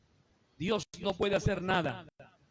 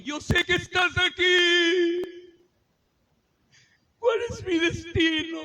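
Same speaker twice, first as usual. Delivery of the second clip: much higher, slow, awed plea; awed whisper-prayer; 10 semitones higher, 7 dB louder, slower than usual. Retold or imitated. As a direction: speak to a felt presence in awe, slowly. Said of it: "lo único que dije". (súplica asombrada, mucho más alta y lenta)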